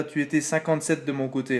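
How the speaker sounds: normal talking voice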